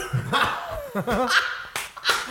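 a big, high burst of real laughter; cracks up at her own story. Real, not acted.

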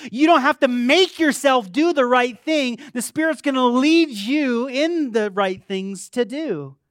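high, loud rallying sermon voice; full-force preaching. Real, not acted. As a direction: exhort the congregation with force.

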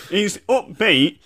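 ordinary delivery

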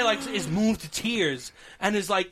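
normal talking voice

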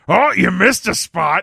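loud taunting quip; Freddy one-liner. Retold or imitated. Host quoting Freddy taunting a burning man, loud. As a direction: taunt it like a gleeful villain. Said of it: "I also have".

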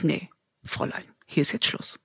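lightly voiced gatekeeper, low and soft; quietly block the way, understated; stern gatekeeper. Stranger shutting off her life path, lightly acted quiet firmness. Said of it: "Und dann kommt einer".

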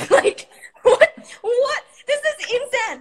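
high, loud, laughing outburst; half laugh, half shout at the insanity; laughing disbelief. Retold or imitated. Drama student reliving the absurd class exercise, laughing high and loud.

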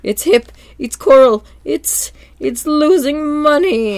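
high, slow, wailing crab-boss voice; mock whiny Mr. Krabs. Mr. Krabs whining to SpongeBob about his failing restaurant, high and slow. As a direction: stretch it out high and pitiful. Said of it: "And then he's like".

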